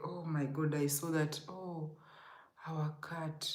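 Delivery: normal talking voice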